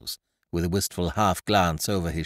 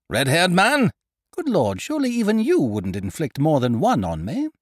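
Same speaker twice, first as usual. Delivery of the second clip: hearty exchange; 7 semitones higher, 6 dB louder than usual. A narrator's reading. Ukridge and narrator argue loudly.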